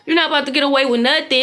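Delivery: normal talking voice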